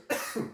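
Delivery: sharp dry cough mid-conversation. Real, not acted. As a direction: cough from a scratchy throat. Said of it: "excuse me for all this coughing".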